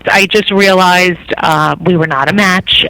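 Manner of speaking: ordinary talk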